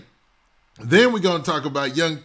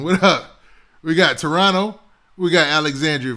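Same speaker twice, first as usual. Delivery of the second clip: ordinary talk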